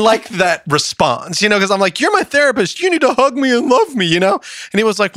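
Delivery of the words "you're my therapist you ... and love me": high, pleading, hammed-up little-kid voice; mock whiny plea. Guest mocks his younger self begging the therapist for comfort, high and whiny. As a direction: beg like a hurt child wanting a hug.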